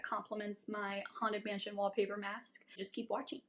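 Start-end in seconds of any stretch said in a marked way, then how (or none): none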